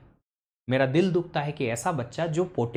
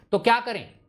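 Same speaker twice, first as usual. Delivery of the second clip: usual speaking voice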